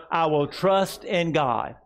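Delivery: slow, weighty words for the church to echo; slow declaration to repeat. Shown as a demonstration. Pastor leading the congregation, slow and deliberate. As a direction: lay each word down slowly. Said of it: "Say it with me".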